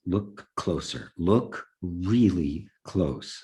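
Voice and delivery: very slow, spaced-out command; authoritative voice. Retold or imitated. The voice orders him to look closer, slow and commanding. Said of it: "carried so much authority".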